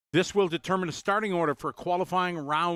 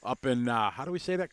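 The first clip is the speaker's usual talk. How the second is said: ordinary delivery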